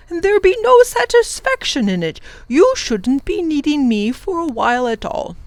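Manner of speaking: an excited teacher driving a point home; animated professor. Acted. The professor urges the children to see differently, animated.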